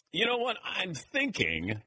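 high start, then drawn-out slow pondering; mock musing. Tipped-off analyst faking a hunch, mock musing. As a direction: pretend to be thinking it up. Mocks insider pretending to guess.

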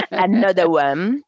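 slow hammed-up American English; English accent Portuguese. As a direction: stretch out the hook like a DJ. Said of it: "Calil, que diabo é Another One". (inglês americano arrastado)

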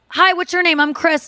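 sweetly retold, courteous hello; polite, sweet. Boyfriend politely introducing himself to a stranger, polite and sweet. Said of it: "he's, like, so polite".

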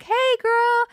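high-pitched put-on girly hello; gushy greeting. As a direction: greet your best friend with exaggerated squeal. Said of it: "it's not like".

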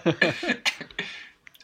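high breathy laugh burst; burst of laughter. Real, not acted. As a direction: break out laughing. After "bitte nicht lachen.

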